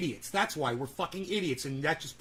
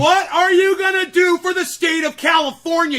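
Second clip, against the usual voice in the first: loud, high furious yell; angry shout; 6 semitones higher, 7 dB louder than usual. Real, not acted. Host yelling at the politician about California, angry shout. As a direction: rage at him across the room.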